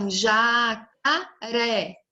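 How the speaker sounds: Jacaré slowly drawn out, ja-ca-ré; syllable by syllable. Shown as a demonstration. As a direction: stretch Jacaré into three clear beats. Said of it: "Três sílabas".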